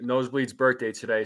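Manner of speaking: usual speaking voice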